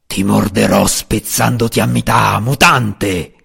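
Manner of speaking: a threatening dragon voice, lightly voiced; dragon shout. A narrator's reading. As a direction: snarl out the threat. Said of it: "urlò il drago". (drago minaccioso, voce appena accennata)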